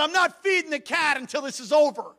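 retold cross refusal; angry announcement. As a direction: declare it in acted irritation. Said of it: "I went in all angry".

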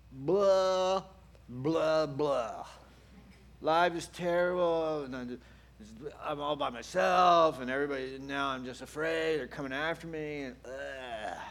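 exaggerated self-pitying moan; mock complaining. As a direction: wail the complaints, then let them sag. Elijah's complaint acted out mockingly.

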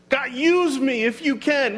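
high, slow mock prayer; doubting plea. Doubter asking God to use them, tentative. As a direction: draw out a high, tentative request.

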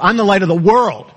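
"world" takes all the weight, then falls; stress on WORLD. Shown as a demonstration. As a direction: run up to a strong "world". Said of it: "he could have emphasized anything".

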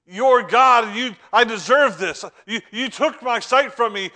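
high, loud mock demand; an indignant entitled claim, acted out. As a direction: throw it at God like he owes you. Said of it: "He didn't demand".

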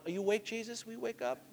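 very soft, tentative question; hushed, polite wake-up call; timid waking. Acted. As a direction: gently try to rouse a sleeper. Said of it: "You're not gonna be going".

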